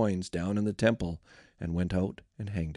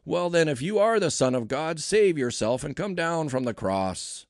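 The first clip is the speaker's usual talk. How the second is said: high, raised-voice jeering; shouted abuse; 6 semitones higher, 4 dB louder than usual. A narrator's reading. Passers-by mocking Jesus on the cross, shouting abuse. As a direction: hurl it as a mocking challenge. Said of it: "shouted abuse".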